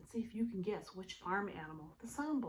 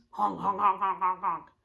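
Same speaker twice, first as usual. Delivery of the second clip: loud lower honks; honking goose; 3 semitones lower, 8 dB louder than usual. Shown as a demonstration. Teacher honking like a goose for kids. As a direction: honk like a goose.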